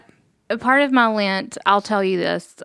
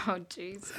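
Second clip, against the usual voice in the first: laughing; 18 dB quieter than usual. Real, not acted. Laughing at friend.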